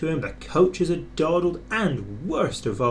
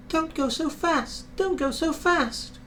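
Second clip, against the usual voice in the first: very high squeaky grumble of the coaches; high complaining carriage voices; grumbling coaches; 14 semitones higher than usual. A narrator's reading. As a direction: moan it like whining carriages. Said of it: "grumbled the coaches".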